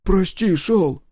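put-on high, loud frightened child; frightened boy. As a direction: warn him like a scared kid. Dozer warns coach.

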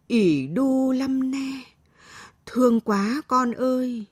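high, drawn-out tender lament; pained pity. A narrator's reading. Grandmother looking at her scratched granddaughter, pained pity. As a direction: draw it out with aching pity. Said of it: "Bà nhìn đứa cháu gái xót xa".

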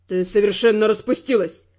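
low male voice, hard and stern; harsh Ferrant. Acted. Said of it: "жёстко сказал Феррант".

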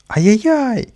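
high, loud yelp; squealed ai-yai-yai; frightened squeal. A narrator's reading. Boy squealing as the dog lunges at him, shrieked yelp. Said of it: "взвизгнул Каприска".

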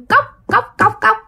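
high, staccato knock sounds; high-pitched vocal knock-knock; imitated glass knocking. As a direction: mimic sharp raps on a window pane. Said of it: "cái tiếng gõ vô kiến".

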